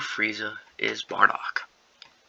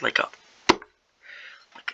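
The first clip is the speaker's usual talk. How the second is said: normal talking voice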